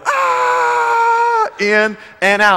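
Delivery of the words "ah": long, very high, loud scream; a thrilled, scared kids' scream through the mud; kids screaming. Retold or imitated. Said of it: "they scream the whole way".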